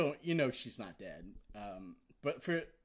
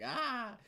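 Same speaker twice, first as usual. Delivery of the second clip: short, much higher mock cry of agony; mock scream; 13 semitones higher than usual. Imitating Discord's pose for viewers, a high mock yelp. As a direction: yelp like a statue frozen mid-scream. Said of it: "he's like".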